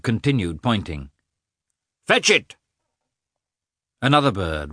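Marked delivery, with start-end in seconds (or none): 2.1-2.6 high, louder command; command to dog. A narrator's reading. Lievin orders his dog after the shot bird, high sharp command.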